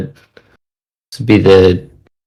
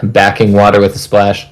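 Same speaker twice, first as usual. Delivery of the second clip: plain reading voice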